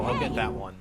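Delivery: ordinary talk, nothing marked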